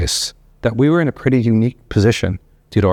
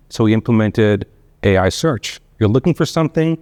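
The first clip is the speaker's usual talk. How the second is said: ordinary delivery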